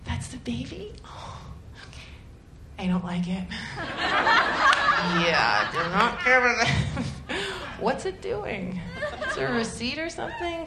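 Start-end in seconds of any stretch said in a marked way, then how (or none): 0.0-3.5 much softer, dazed muttering; hushed, woozy mock letdown; muttered disappointment. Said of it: "I'm like". 7.8-10.6 high, soft, puzzled voice; mock clueless new mom; baffled. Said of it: "confused new mom".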